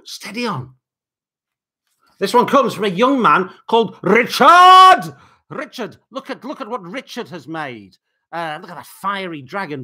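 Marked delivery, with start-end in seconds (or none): none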